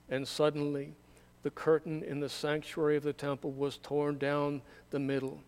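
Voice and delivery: normal talking voice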